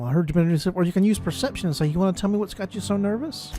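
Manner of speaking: usual speaking voice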